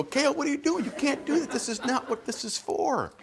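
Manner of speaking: put-on high, alarmed scolding of a toddler; dad freaking out at his son on the counter, high and alarmed; freaked-out dad. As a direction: pitch it way up in parental panic. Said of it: "I freaked out right away".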